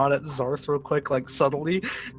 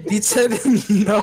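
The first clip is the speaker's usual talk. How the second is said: laughing; 4 semitones higher, 5 dB louder than usual. Real, not acted. Laughing at refusal.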